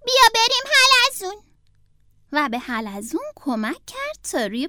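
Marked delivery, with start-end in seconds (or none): 0.1-1.4 higher, louder hurried little turtle; a young turtle in a rush; hurried Franklin. Acted. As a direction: Franklin telling the fox he is in a hurry. Franklin resolute.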